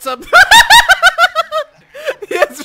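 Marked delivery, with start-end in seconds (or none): 0.3-2.6 high, loud laughing shriek. Real, not acted.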